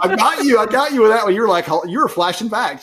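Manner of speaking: high, loud burst of playful triumph; gleeful teasing. Real, not acted. Podcast guest teasing the host who fell for the joke, gleeful. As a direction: crow loudly at catching them out.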